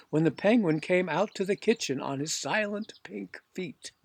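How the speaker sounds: usual speaking voice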